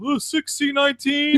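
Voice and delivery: drawn-out high call across the room; calling out a number. Retold or imitated. Clerk calling the next number to the waiting room, drawn out and high. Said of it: "I hear they manually call".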